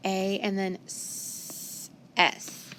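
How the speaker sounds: a, and then s, s drawn out softly; sounding out. Shown as a demonstration. Teacher sounding out the word gas. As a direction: stretch each sound slowly. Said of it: "So I hear".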